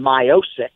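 "Miocic" as my-OH-sick; old mispronunciation. Shown as a demonstration. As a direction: say "Miocic" with MY first and a hard sick ending. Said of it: "seen Stipe go from Miocic".

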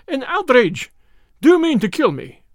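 a coward shrieking in terror, voice climbing; high hysterical voice. A narrator's reading. The Russian cries out at Julius's gun, high and hysterical. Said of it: "cried the Russian in a high, hysterical voice".

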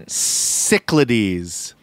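"Cyclides" said slowly as SIK-luh-deez; slow careful correction. Shown as a demonstration. Co-host announcing the name she looked up, slow and deliberate. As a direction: spell out "Cyclides" sound by sound, slowly. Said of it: "I got it".

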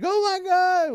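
high, loud imitation of dismay; loud mock shock; shocked outcry. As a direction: mimic a roommate crying out in horror. Quotes roommate seeing his car.

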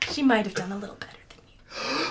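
breathy, unvoiced tease; whispered aside. Real, not acted. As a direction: whisper a gentle put-down. Teasing aside to boasting player.

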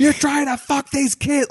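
retold scream. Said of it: "just starts screaming at him".

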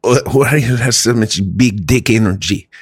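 put-on Russian accent as Igor; impressed Igor with a thick Russian accent. Igor in a Russian spa admires a man's confidence, Russian accent. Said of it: "the Russian accent".

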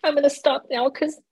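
ugly crying. Real, not acted. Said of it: "now I'm ugly crying".

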